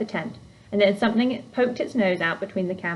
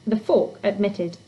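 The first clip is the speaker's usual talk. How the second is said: usual speaking voice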